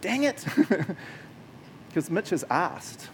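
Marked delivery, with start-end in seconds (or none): 0.0-0.4 frustrated Satan. Retold or imitated. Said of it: "Satan would be like".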